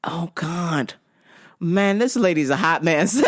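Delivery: ordinary talk, nothing marked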